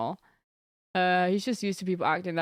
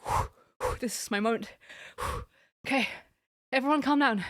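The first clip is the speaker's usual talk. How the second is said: nervous breathing; 2 semitones higher than usual. Retold or imitated. Psyching herself up.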